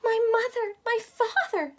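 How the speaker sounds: high, joyful cry; cried out. Acted. Mahalia spotting her family in the crowd, a high joyful cry. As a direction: call out with joyful relief. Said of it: "Mahalia cried".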